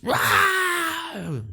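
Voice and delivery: high wild monk scream as he runs off; unhinged shriek, fleeing; crazed scream. Acted. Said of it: "he just starts screaming".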